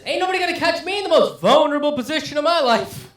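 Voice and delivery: high, loud hammed-up paranoid friend; gun-owning friend explaining himself, hammed up and high; mock paranoid gun owner. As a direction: play the friend defending his toilet gun, overdone.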